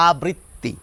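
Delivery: আবৃত্তি built as a plus britti; last step of the drill; final step demo. Shown as a demonstration. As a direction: finish by adding a to make আবৃত্তি. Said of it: "তার আগে একটি আ লাগিয়ে দিন".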